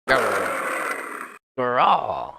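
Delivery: tiger growl. Acted. Voicing the circus tiger for the chat, a growl. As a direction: snarl like a big cat.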